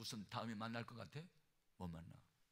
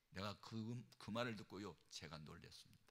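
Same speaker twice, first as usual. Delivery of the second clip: normal talking voice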